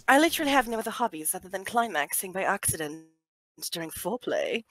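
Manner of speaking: put-on card read in a British accent. Reading a card to friends, British accent. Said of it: "that particular card that was British".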